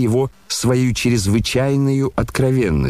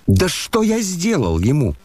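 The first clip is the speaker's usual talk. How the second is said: crying out; 7 semitones higher than usual. A narrator's reading. Ippolit complains about the general, crying out in protest. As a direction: protest in a raised, high pitch. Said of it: "вскричал Ипполит".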